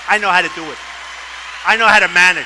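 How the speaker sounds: loud, hammering repetition; crowd-pleasing loud insistence; emphatic boast. Real, not acted. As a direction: drive it home to the crowd. Campaign speech, emphatic repeated boast.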